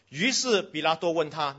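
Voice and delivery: usual speaking voice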